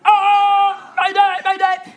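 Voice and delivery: high, loud Mayday yell; mock distress shout. Comic pretending an elephant sneeze disaster, hammed-up loud mayday call. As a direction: shout it like a panicked radio call.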